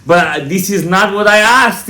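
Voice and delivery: high, loud imitated complaint; insistent objection. Owner objecting to the rabbi's answer, insistent imitation. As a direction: protest loudly as the pushy questioner.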